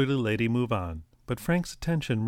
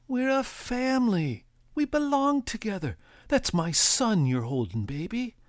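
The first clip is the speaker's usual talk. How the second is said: pleading father; 12 semitones higher than usual. A narrator's reading. Young father desperately pleads with the mother. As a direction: beg her to stay a family.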